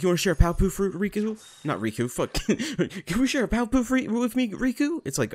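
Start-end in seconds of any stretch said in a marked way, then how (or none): 0.0-1.4 lightly mocked girlish voice, raised pitch; a light, higher impersonation of the heroine; Kairi. Heroine offering to share fruit, light higher mock voice. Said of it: "Kairi's like". 3.1-5.0 high-pitched mock heroine voice; Kairi. Heroine asking the wrong boy to share fruit, high mocking voice. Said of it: "Kairi's like".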